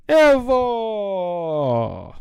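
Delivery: high, loud exclaimed Evo; loud mock cry; playful shout. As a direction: blurt it out like a cheer.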